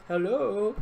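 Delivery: imitating a badly faked phone voice; mock disguised hello. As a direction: put on a voice that fools nobody. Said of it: "he disguises his voice".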